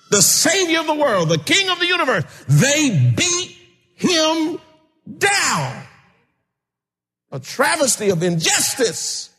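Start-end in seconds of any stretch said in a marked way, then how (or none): 0.1-5.8 full-force preaching. Acted. Preacher to congregation on Jesus beaten, full-force preaching. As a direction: lift it up and stretch "they beat him down".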